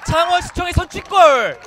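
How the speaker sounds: loud, high goal call; goal celebration shout. Acted. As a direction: yell the goal call at full power. Goal call in live commentary.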